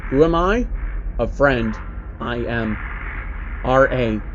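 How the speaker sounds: ordinary delivery, nothing marked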